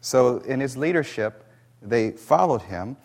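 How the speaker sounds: ordinary talk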